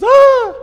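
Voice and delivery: high, loud shriek of horror; a sudden mock scream on waking; shocked scream. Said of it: "he goes".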